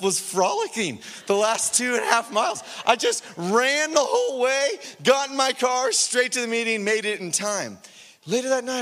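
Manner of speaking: ordinary delivery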